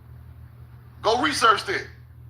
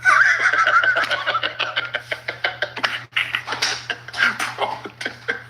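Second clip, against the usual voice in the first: laugh. Real, not acted. Laughing at own slow line.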